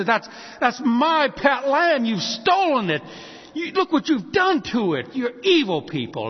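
loud, high outrage, acted out; horror and anger. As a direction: play the horrified owner confronting thieves. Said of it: "the horror and the anger".

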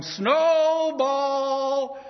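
long, high, loud call. Acted. Father calling for the lamb outside, loud and long. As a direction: shout it into the dark.

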